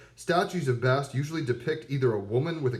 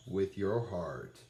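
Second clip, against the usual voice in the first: tender; 6 semitones lower, 6 dB quieter, much slower than usual. Real, not acted. Tender answer to own question.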